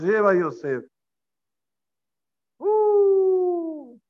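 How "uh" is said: long, high "uuuh"; mock crying howl; imitated wail. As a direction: wail on one high sustained note. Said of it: "lloros, gritos".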